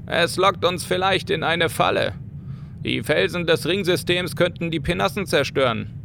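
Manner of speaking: lightly voiced nervous crewman; worried Irish sailor. O'Reilly warns captain.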